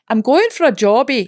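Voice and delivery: lilting Edinburgh Scottish accent. Shown as a demonstration. Showing off her first accent, a lilting Edinburgh accent. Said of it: "I think I can do two accents".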